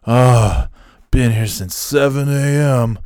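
imitating a woe-is-me complainer; weary dragging. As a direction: drag it out like a put-upon worker. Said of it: "rolling his eyes and dragging it".

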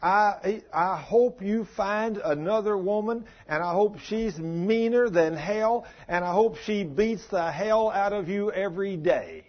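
angry wife. Retold or imitated. Wife cursing husband as she leaves: retold slow, bitter fury. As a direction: drag out each wish like a furious, scorned wife. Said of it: "And she said".

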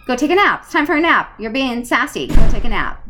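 mock bossy kids ordering a nap; lightly voiced kids telling mom to rest; kids bossing mom. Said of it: "They actually say to me".

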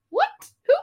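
high, squeaky repeated what and who; disbelief. Retold or imitated. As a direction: stammer in baffled disbelief. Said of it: "I was like".

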